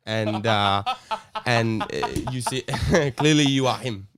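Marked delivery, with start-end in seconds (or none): 2.6-4.2 laughing through the words; chuckling at his own accent. Real, not acted. Teller laughing at his own accent attempt. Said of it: "you nailed it".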